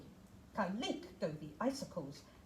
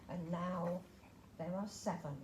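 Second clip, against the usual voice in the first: ordinary delivery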